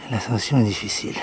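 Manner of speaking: ordinary talk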